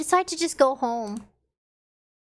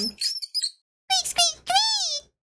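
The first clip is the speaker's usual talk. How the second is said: much higher squeaky mouse noises; high squeaking mouse; mouse squeaks; much slower than usual. Acted. Voicing the struggling mouse.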